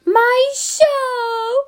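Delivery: playful fake sob with laughter; mock wail. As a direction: wail over the ruined show. Mock wail for ruined show.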